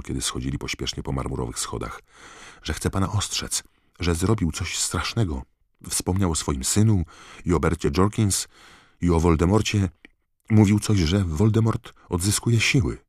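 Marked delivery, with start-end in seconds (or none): 2.6-13.0 higher voice, growing louder with each item; urgent Harry. A narrator's reading. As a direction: report the alarming news with rising urgency. Harry urgently reports to Dumbledore. (przejęta, nagląca relacja)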